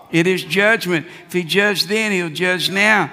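ordinary talk, nothing marked

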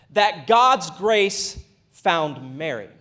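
ordinary talk, nothing marked

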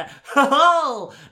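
loud, high mock cackle; hammed-up triumphant "oh-ho"; villain laugh. Imitating snatchers bound for the Dark Lord, gloating laugh.

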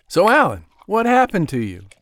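smooth gentleman voice. Acted.

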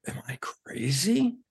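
breathless whisper to himself; a hushed, anxious aside; whispered self-doubt. Retold or imitated. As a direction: whisper it like a worried thought. Doubting himself after walkout.

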